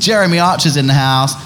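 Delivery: ordinary talk, nothing marked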